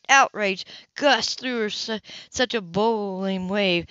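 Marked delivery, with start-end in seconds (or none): none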